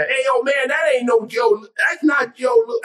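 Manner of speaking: very high, urgent protest; high-pitched, scolding objection; correcting entourage guy. Acted. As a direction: jump in to set the old man straight. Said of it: "started to correct the old man".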